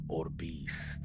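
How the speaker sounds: breathy horror-trailer hush; dramatic whisper. Acted. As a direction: drop to a spooky whisper on the last words. Dramatic end of a movie synopsis.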